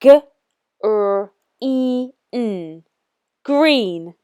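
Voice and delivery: G, Er, E, N then Green, slow and clear; teacher asks kids to spell green with her; sounding out together. Shown as a demonstration. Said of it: "Say it with me".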